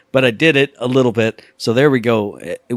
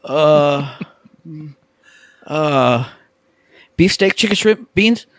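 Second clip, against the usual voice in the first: hesitant, stammering customer; 2 semitones higher, slower than usual. Retold or imitated. Mocking friend's hesitant order.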